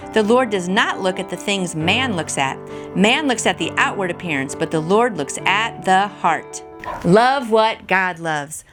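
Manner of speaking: ordinary delivery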